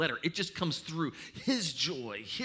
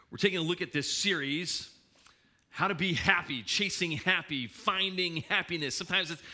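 ordinary talk

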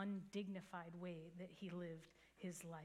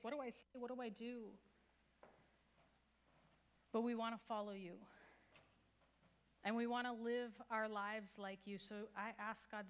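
calm, earnest prayer to God; closing prayer. Acted. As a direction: pray for the congregation, steady and sincere.

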